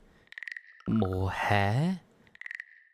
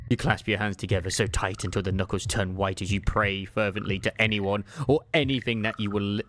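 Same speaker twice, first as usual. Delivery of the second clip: ordinary delivery, nothing marked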